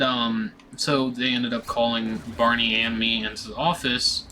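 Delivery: ordinary delivery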